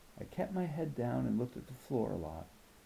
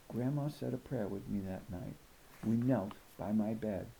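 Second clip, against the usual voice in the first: usual speaking voice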